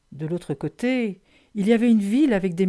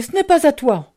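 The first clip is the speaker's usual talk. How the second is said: loud, raised protest; cried out; 6 semitones higher, 7 dB louder than usual. A narrator's reading. Child telling the bear it isn't his, cried out. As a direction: call out an indignant objection, high and loud. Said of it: "ai-je crié".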